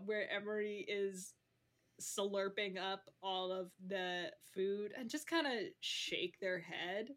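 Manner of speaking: usual speaking voice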